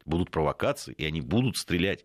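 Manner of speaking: ordinary delivery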